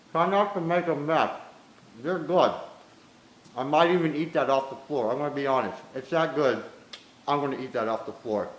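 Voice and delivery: talking with a mouth full; chewing while talking. Real, not acted. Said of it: "Don't talk with your mouth full".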